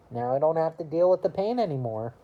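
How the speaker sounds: ordinary talk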